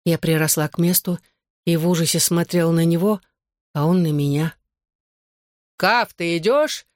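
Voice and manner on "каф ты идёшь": drawn-out, higher-pitched call; mildly raised, stretched-out call to a friend. A narrator's reading. A friend calls Johnny to come along, calling out. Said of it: "крикнул кто-то из его друзей".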